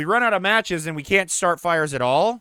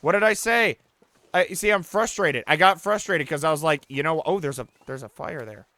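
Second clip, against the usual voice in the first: ordinary talk, nothing marked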